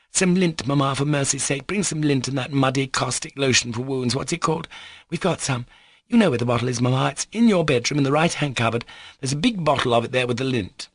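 urgent, high-pitched orders; bossy girl in a flap; frantic imperious daughter. Acted. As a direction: badger Mamma impatiently. Lisa ordering Mamma about.

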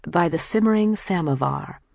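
plain reading voice

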